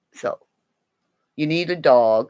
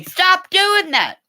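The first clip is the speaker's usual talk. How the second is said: mock scolding; 6 dB louder, slower than usual. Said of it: "people would say".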